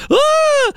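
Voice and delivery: short high shriek of panic; mock scream. Panicking beachgoer lets out a high scream. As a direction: cry out like someone in a panicking crowd. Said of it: "you hear somebody scream".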